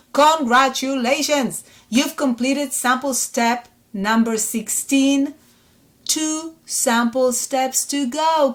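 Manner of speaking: high, drawn-out celebratory delivery; big elated announcement, stretched words; excited congratulations. Real, not acted. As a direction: cheer the learner on, slowly and proudly. Congratulating learners on finishing.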